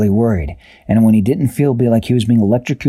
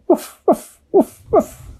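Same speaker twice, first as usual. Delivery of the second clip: tiny high woofs; puppy woofs; slower than usual. A narrator's reading. Baby creatures hatching, tiny high woofs. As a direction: squeak them like little pups.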